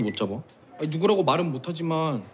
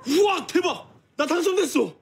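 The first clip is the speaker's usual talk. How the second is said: thrilled high shout; excited shout; 13 semitones higher than usual. Acted. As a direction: yell with joy. Winning a prize, shouting.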